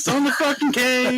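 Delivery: high, fed-up retort; exasperated. Retold or imitated. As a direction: snap back in disbelief. Mock retort to birthday question.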